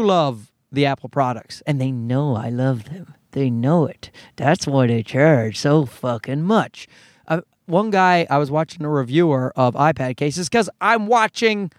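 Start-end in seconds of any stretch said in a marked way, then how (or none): 1.7-6.9 knowing grumble. Real, not acted. Rueful aside about Apple.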